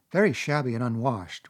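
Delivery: usual speaking voice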